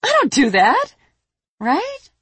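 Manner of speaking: high, loud protest rising into an uncertain question; indignant protest. A narrator's reading. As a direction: squeak it out as an affronted young dragon, then check. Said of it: "Prika said and frowned".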